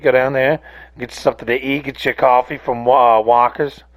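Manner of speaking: ordinary delivery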